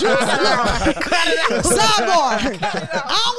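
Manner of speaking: loud, laughing sidebar; laughing aside. Real, not acted. Laughing as he turns to tease his girlfriend Juice. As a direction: call it out through laughter.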